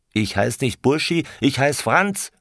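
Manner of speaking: high, indignant, mildly shouted protest; angry cry. A narrator's reading. Franz rejects the nickname Burschi, crying out angrily. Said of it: "schrie Franz".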